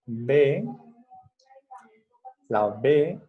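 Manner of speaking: "B" with vibrating lips; voiced B demo. Shown as a demonstration. Teacher shows a child the voiced "B". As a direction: buzz the "B" with your lips. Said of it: "es bilabial inclusiva".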